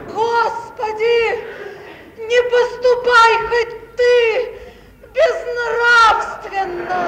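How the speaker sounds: slow, loud pleading to God; anguished, drawn-out plea; despairing prayer. Acted. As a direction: beg heaven not to allow it. Mother prays in despair.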